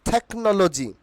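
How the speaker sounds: "টেকনোলজি" said thek-no-lo-ji; breath after the t in "টেকনোলজি"; corrected pronunciation. Shown as a demonstration. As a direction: say "টেকনোলজি" with a puffed t. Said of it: "কি হবে?".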